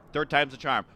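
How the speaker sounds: ordinary talk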